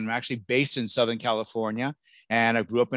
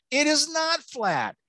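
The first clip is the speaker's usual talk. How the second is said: much higher and slow; mock emphatic; slower than usual. Speaker debunks a silly myth for parents, slow high emphatic denial. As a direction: deny it with exaggerated emphasis. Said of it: "the myth".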